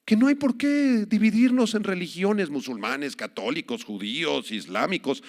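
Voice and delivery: mocking tone, high, quoting the heretics; mock quote. Mocking preacher quotes opponents' view. (burla aguda imitando a los herejes)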